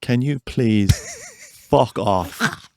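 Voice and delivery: slow, mock-polite put-down; drawn out with a laugh in it; sweetly telling a tour crasher to leave. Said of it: "say to someone".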